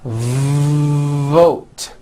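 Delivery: VOTE with a long buzzing V before the vowel; stretched V. Shown as a demonstration. Teacher showing long V, buzzing stretched VOTE. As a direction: hold the V buzz, then release into VOTE. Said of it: "stretch out the V like this".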